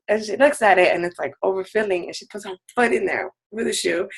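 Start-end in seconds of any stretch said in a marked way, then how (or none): none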